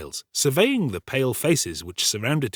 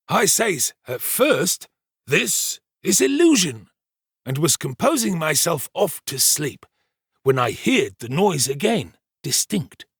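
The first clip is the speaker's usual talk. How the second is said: higher, deliberate pompous servant voice; self-important storyteller Giles; 7 semitones higher, 4 dB louder than usual. Acted. Servant retelling the night's alarm to the kitchen, pompous storytelling.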